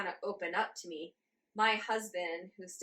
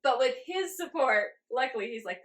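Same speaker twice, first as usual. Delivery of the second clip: ordinary talk, nothing marked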